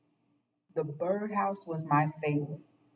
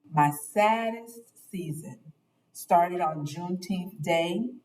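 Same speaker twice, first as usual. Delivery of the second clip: ordinary delivery, nothing marked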